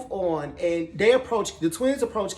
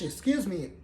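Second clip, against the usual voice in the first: ordinary talk, nothing marked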